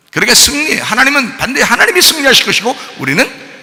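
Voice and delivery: shouted sermon, pitched high; full-force preaching. Real, not acted. Preacher warning believers of attack in the last days, shouting. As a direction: raise the alarm to the congregation at the top of the voice.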